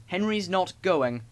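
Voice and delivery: slow, loud and rude. A narrator's reading. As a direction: drag it out defiantly. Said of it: "said Gordon rudely".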